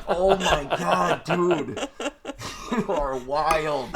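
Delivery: slow, high words broken by real laughter; laughing through words. Real, not acted. Guest telling hosts the cop story, laughing hard. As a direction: laugh helplessly while you get it out.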